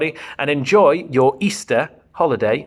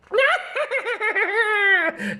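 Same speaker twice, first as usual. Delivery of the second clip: evil witch cackle. Acted. The Wicked Witch gloating over Dorothy, high evil cackle. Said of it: "cackled the Wicked Witch".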